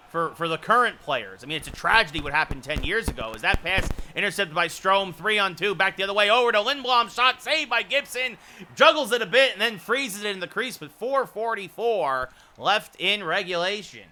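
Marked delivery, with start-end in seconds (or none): 3.3-14.0 fast, rising live hockey call; rapid hockey play-by-play. Acted. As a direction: call the action as it happens.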